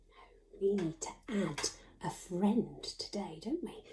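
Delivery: hushed secret whisper to the class. Acted. Teacher whispering to the class that a new friend is coming. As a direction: lean in and whisper it.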